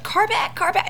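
very high, shrill-pitched imitation; mock frantic call. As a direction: squeal the warning in a panic. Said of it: "So she's like".

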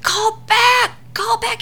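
very high, strained mock screech; frantic yelling. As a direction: screech the warning like she's panicking. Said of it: "she's freaking out".

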